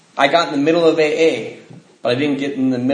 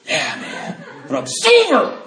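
mocking a smug drunk; 13 semitones higher, slower than usual. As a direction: sneer it out like a smug newcomer. Mocking a newcomer's excuse.

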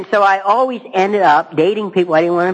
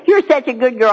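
higher, louder sing-song praise of the sister; mock doting mother; 7 semitones higher, 3 dB louder than usual. Mother praising the older sister, in a high gushing voice. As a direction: gush like a fond mother.

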